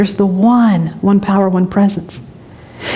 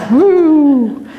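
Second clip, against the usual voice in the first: laughing woo; 6 semitones higher than usual. Real, not acted. Laughing exclaim.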